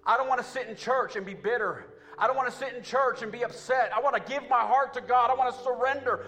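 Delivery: loud, high, rising preacher's cry to the church; full-force preaching. Acted. Pastor crying out to his church about losing flavor, preaching at full force. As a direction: preach it out at full force, building with each line.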